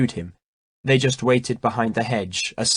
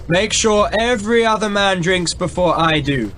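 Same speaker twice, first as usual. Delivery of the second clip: higher, carrying order; loud order; 7 semitones higher, 4 dB louder than usual. Acted. Prince orders his men loudly across the hill. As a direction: project it to men forty paces off. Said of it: "he ordered in a voice loud enough to be heard thirty or forty paces away".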